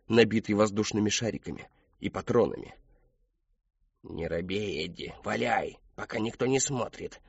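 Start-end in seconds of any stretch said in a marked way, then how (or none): 4.0-7.2 hushed, sly encouragement; whispered egging on. A narrator's reading. Robot cat urges Eddie while nobody watches, whispering. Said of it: "зашептал Плохой Кот".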